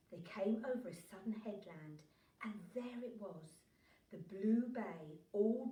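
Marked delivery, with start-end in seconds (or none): none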